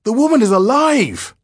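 high, loud cry of horror; horrified shout at a dreadful discovery; shocked exclamation. Acted. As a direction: exclaim it aghast, sustained high pitch. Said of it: "he exclaimed".

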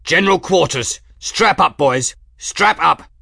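loud, high command; rally the men to stations; shouted orders. A narrator's reading. Officer orders crew to battle stations.

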